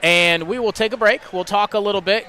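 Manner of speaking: upbeat radio sign-off to the break; radio announcer. Acted. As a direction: wrap up the game for listeners. Radio host wraps up the game.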